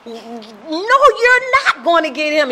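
slow, loud, high-pitched refusal; imitating domineering dad. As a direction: forbid it as the furious father. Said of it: "And my dad would go".

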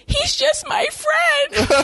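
high, slow, whiny mock of the girl; mocking high whine. Mocking the girl as she insists he's just a friend, high and whiny. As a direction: squeak it out like a lying girlfriend.

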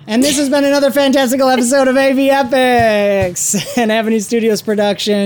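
announcer outro. Acted.

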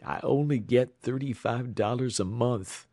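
plain reading voice

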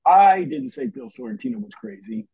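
"I" lifted and pushed first; stress on I. Shown as a demonstration. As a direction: hit "I" in "I didn't say". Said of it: "Each sentence meant something different".

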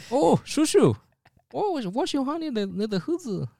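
put-on high, thin old man voice. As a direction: play a creaky old uncle. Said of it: "I said in an old man voice".

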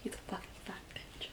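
whispered aside; whispered insult. Real, not acted. Insult said under breath.

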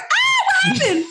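very high, loud, laughing disbelief; laughing shock. Retold or imitated. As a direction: gasp and laugh at what went wrong. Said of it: "And I was like".

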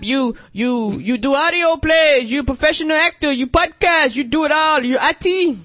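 high, loud mock Jamaican accent; playful bad Jamaican accent impression. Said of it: "[name] does not do voices".

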